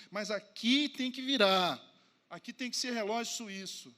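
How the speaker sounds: loud, rising sermon voice; forceful preaching. Acted. Preacher urging the church to be family, loud. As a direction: press the point home to the congregation.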